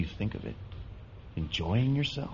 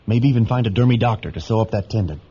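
raised voice; 8 dB louder than usual. Acted. Boy speaks up over the truck.

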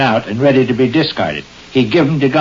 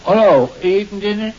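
calling out; 8 semitones higher than usual. Acted. Still calling out though answered.

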